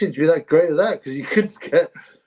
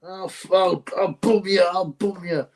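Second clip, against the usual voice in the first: mocking a boxer's voice; 5 semitones higher, slower than usual. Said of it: "I could hear his stupid voice".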